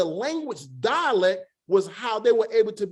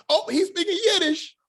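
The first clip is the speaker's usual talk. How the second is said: mock exclamation, high and laughing; comical; 9 semitones higher than usual. Teacher clowning about the man speaking Yiddish, comical. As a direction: jokingly blurt it out, pitch jumping up. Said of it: "I'm being comical with that part".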